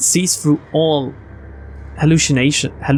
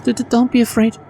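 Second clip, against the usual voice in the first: put-on higher girl's voice, stuttering as she taunts; stammering teasing girl; 8 semitones higher than usual. Neneko mocking Ikeda as a scaredy cat, high and stuttering. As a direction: taunt him while tripping over every word.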